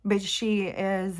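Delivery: ordinary talk, nothing marked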